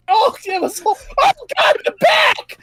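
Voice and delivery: high, shrill panicked goblin yell; panicked hobgoblin shout. Acted. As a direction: scream it in panic, voice cracking. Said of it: "one hobgoblin who's like".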